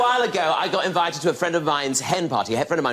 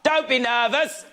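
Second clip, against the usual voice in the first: glib reassurance; 6 semitones higher, slower than usual. Retold or imitated. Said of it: "and they go".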